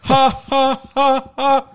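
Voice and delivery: high staccato ha ha ha ha; clipped four-beat mock laugh; four-syllable laugh. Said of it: "only four syllable laughs".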